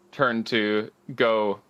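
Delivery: ordinary talk, nothing marked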